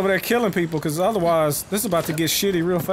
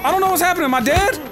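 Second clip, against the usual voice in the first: real panic, voice climbing higher; panicked confusion; 10 semitones higher than usual. Real, not acted. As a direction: gamer freaking out mid-fight. Getting beaten, confused in game.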